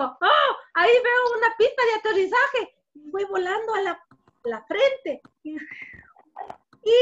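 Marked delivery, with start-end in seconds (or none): 0.0-2.8 high, loud storybook amazement; excited discovery. Acted. Fly spots the forehead as a runway, excited. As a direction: cry out at a wonderful sight.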